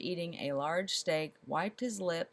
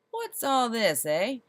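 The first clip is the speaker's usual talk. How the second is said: a loud, higher bark at the crowd; 5 semitones higher, 7 dB louder than usual. A narrator's reading. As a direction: bark it like a stern lord. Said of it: "he barked".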